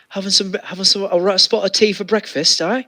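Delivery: a hammed-up posh English accent; mock English accent. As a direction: tease like a stage Englishman. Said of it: "in that accent".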